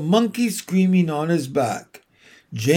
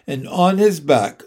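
ordinary delivery, nothing marked

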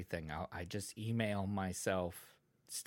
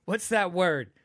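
higher-pitched exasperated groping for a word; frustrated word-searching; 8 semitones higher, 6 dB louder than usual. Retold or imitated. Podcaster re-enacting a forgotten word, mock frustration. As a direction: replay your own flustered tip-of-the-tongue struggle. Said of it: "when I was trying to say the word sarcasm, but I couldn't think".

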